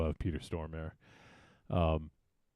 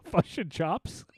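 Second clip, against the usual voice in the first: laughing whisper; 8 semitones higher, slower than usual. Real, not acted.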